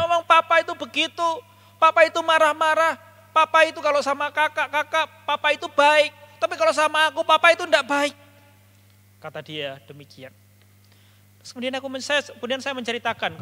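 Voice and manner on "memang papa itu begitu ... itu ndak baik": high, loud aggrieved younger sibling; angry complaining. Retold or imitated. Younger sibling complains about Papa to the elder, angry and aggrieved. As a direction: act out a sibling's heated grievance about Papa. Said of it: "dengan keluh kesahnya, dengan marahnya".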